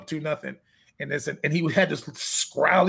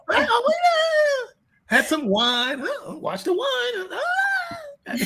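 much higher, squealing mock exchange, cracking with laughter; mock scolding; much slower than usual. Trainer mocking the clients' wine excuse, squealing high and laughing.

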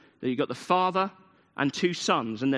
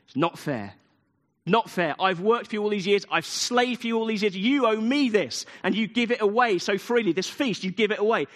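put-on furious older brother; 5 semitones higher than usual. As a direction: spit out the grievance against the father. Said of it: "'cause he's furious".

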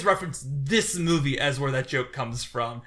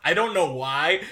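normal talking voice

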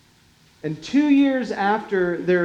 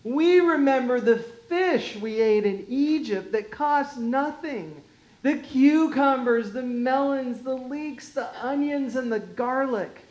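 whiny voice; 6 semitones higher than usual. Acted. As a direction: whine it like a spoiled child. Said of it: "you've got to read this with a whiny voice".

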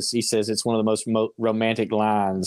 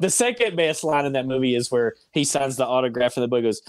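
ordinary talk

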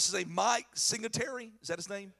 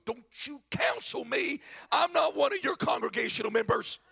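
mock angry wife, raised voice; wife snapping back; 3 semitones higher, 4 dB louder than usual. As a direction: imitate an irritated wife telling him off. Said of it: "She'd go".

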